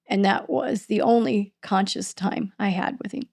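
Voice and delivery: normal talking voice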